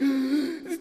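high, sharp intake of breath; imitated child's gasp. Daughter caught by dad, imitated high gasp. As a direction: gasp like a thrilled little kid. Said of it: "And she's like".

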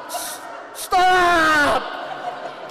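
loud, high yell with a laugh; yelling stop. Retold or imitated. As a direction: shout at the fleeing cat. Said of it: "And I'm like".

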